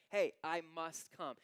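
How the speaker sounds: loud and slow, no question in it; Jesus declaring he will come, loud and slow; insistent loud quote. Retold or imitated. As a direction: insist he's coming over, drawn out and firm. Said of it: "He's like".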